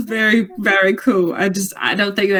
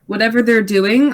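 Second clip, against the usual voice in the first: ordinary delivery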